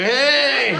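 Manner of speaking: loud, hammed-up greeting; mock cheerful hey. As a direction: belt out a bright hey with a laugh. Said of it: "I go".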